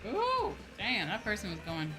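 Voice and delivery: normal talking voice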